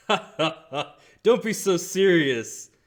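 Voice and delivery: big, loud, high laugh into the words; loud jovial teasing through laughter; chuckling. Acted. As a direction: laugh it off boisterously at the top of your voice. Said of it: "crack a chuckle".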